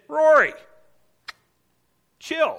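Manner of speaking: loud, high call of the name; God says chill. Pretend. God telling the preacher to relax, loud and high. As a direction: shout Rory's name, then a falling chill. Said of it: "That's how he would say it today".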